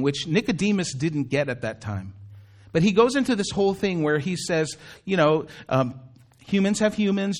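normal talking voice

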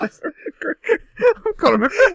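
high, squeaky Irish leprechaun voice; Conor McGregor. Acted. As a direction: squeal the name like a crazed leprechaun. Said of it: "impression of Conor McGregor's like".

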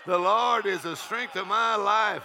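slow, high preaching call; triumphant proclamation. Acted. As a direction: declare the verse, pitched right up. Preacher proclaims scripture between laughs.

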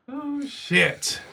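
ordinary talk, nothing marked

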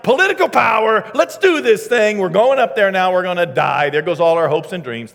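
loud sarcastic bluster, starting high and sinking; loud sarcasm. Acted. Thomas rallying disciples toward certain death, loud sarcasm. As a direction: shout it sarcastically, then let the pitch drop. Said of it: "without a little bit of sarcasm".

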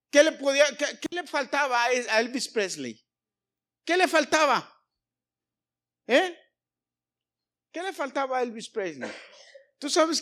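preaching shout. Real, not acted. Preacher challenges congregation.

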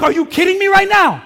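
very high, very loud disbelief; yelling in outrage. Retold or imitated. Pastor yelling at a line-cutter in the grocery store. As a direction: explode at him, pitch way up. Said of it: "But I started yelling".

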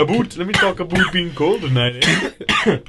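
a light Canadian accent played for laughs; mock Canadian accent mocking a friend's Canadian crush. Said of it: "It's a Canadian".

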